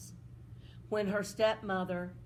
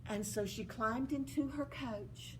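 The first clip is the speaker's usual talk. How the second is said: ordinary delivery, nothing marked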